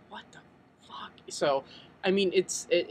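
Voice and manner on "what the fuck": mock-shocked, very soft whisper; stunned whisper. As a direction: whisper it in feigned disbelief. Imagined shock at hearing an accent.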